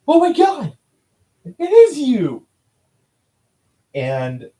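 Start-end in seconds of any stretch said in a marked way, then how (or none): 0.1-2.5 a much higher, louder gasp of disbelief, slowed down; stunned recognition. Retold or imitated. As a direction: shriek it like you can't believe who's standing there. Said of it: "He does this. He's like".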